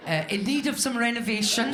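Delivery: laughing through a mock estate-agent sales pitch; estate agent listing. Mocking her dating profile as a house listing, hammed-up estate agent. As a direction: read it like a property ad, giggling. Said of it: "like I was selling my house".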